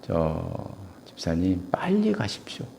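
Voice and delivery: urgent whispered advice. Retold or imitated. Pastor whispering advice to the deacon on the phone. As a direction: hush it like a secret warning. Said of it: "제가 그랬어요".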